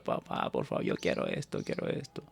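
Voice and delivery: put-on whimpering, breathy child's plea; crying child. Child crying to his father for a toy. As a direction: plead through tears like a kid. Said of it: "y se pone a llorar".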